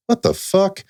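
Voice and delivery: high, startled what the fuck; shocked outburst. Retold or imitated. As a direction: blurt it out in disbelief. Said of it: "It's exactly what I said".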